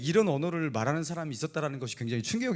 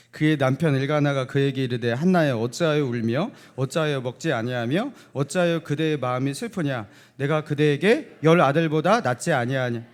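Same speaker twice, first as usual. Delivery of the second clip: scripture reading; 5 dB louder than usual. Acted.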